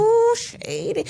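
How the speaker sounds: drawn-out, high-pitched "ooh"; mock scandalized reaction. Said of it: "I was just like".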